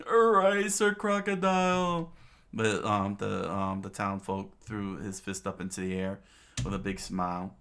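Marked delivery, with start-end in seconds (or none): 0.1-2.1 high, loud drawn-out cheer; raised, hammed-up hurrah; cheering townsman. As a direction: holler support for your hero. Townsman cheers, fist in air.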